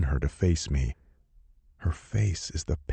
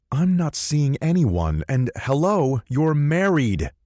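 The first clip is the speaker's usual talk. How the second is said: louder, higher heated retort; angry outburst; 10 semitones higher, 5 dB louder than usual. Acted. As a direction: fire it back at her. Hero angry argument.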